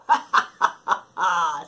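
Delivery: breathy villain's ha ha; evil laugh. Acted. Wolf gloating after hiding grandma, evil laugh. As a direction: cackle like a wicked wolf.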